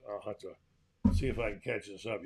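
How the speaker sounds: normal talking voice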